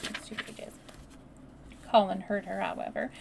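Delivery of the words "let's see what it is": hushed, very soft whisper; barely audible whisper. A narrator's reading. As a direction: whisper it under the breath. Unvoiced hushed words.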